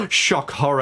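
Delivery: high theatrical gasp; mock shock. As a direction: gasp in hammed-up horror. Mocking fabricated TV drama.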